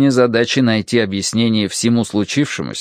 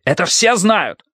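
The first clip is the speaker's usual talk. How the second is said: high, insistent cry; heated high-pitched retort; shouted protest; 9 semitones higher than usual. A narrator's reading. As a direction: shout it as common knowledge. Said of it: "закричал командир Дуй".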